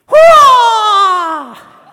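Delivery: long, very high, loud yell; martial-arts cry. Retold or imitated. Big guy confronting his pursuer, imitated fighting yell. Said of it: "and he goes like this".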